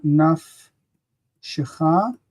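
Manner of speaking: nafshecha drawn out slowly, ending on stressed CHA; stress on final CHA. Shown as a demonstration. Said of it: "the, the stress shifts".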